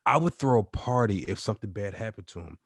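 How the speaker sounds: ordinary talk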